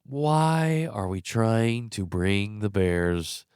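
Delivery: ordinary delivery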